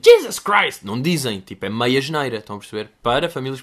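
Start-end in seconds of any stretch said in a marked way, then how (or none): 0.0-0.8 a loud high exclamation of "Jesus Christ!"; exclaiming Jesus Christ. Shown as a demonstration.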